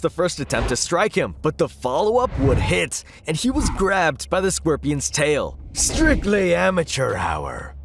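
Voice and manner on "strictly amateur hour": slow, drawn-out villain voice; villain's taunt. A narrator's reading. Scorpion gloating over the hero in his grip, taunting slowly.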